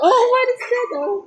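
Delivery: high, laughing surprise; surprised laugh. Real, not acted. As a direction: gasp it out through a laugh. Surprise at odd game item.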